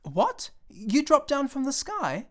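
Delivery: high, slow astonished cry; a boy amazed a man fell from the sky; cried out. A narrator's reading. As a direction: gasp it out in wonder. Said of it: "He cried out then".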